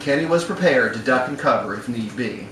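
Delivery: usual speaking voice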